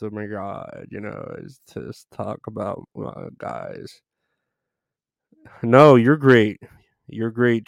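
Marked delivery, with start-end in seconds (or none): 0.0-4.0 put-on soft, low girly-gossip parody; mock gossiping girl. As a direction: play girls gossiping, much softer. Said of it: "That's how I do this voice".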